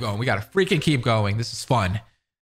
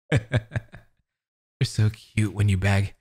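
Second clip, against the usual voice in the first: slow, lightly chuckling stalker, lower; creepy chuckle; 4 semitones lower, much slower than usual. Acted. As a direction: savour it slowly with a little laugh. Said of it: "until I heard a slight chuckle".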